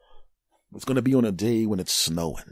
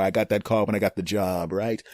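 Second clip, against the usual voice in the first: ordinary delivery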